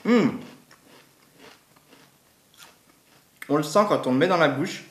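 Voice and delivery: talking while chewing chip; muffled words around food; mouth full, chewing. Real, not acted. Seen 0: Chip put in mouth at -4; lips closed, chewing during the words.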